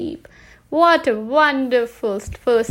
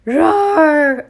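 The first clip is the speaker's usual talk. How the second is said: loud, high mock roar; a child's playful monster roar; little girl doing a monster in a bedtime story, mock roar; 5 semitones higher, 8 dB louder than usual. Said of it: "a big monster who went".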